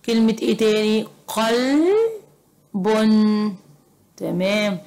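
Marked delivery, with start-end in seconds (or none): 1.3-3.6 قلب with a very long held middle; slow sounding-out. Shown as a demonstration. Teacher writing a word for pupils, slowly sounded out.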